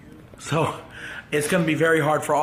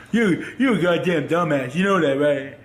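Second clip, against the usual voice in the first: imitating Dad. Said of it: "I can hear Dad".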